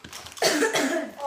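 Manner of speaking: real coughing from the spice; coughing fit. Real, not acted. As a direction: cough hard, choking on heat. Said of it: "My mouth is burning".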